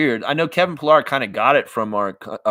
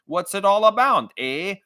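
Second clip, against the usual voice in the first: hammed-up Canadian voice, higher, leaning on every "eh"; mock Canadian accent; 8 semitones higher, slower than usual. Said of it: "Or you talking about".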